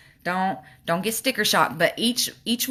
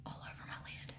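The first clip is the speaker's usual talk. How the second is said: barely audible whisper to oneself; absent-minded whisper while concentrating; 24 dB quieter, faster than usual. Real, not acted. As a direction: repeat it under your breath in a whisper. Whispers it to herself while applying.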